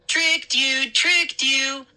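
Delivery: high sing-song gloat; cheering taunt. A narrator's reading. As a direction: cheer like a prankster. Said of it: "I cheered".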